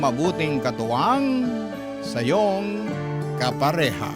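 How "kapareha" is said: normal talking voice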